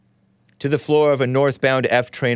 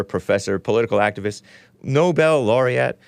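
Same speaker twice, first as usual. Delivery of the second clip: ordinary talk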